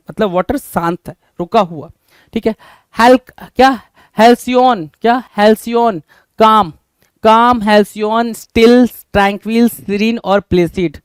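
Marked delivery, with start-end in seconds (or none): none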